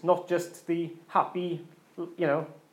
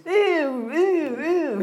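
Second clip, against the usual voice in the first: much higher, louder ew ew ew; disgusted girl; 10 semitones higher, 4 dB louder, much slower than usual. Retold or imitated. Preacher voicing Disgust from Inside Out, high squealing ews. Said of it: "there's this girl character who's".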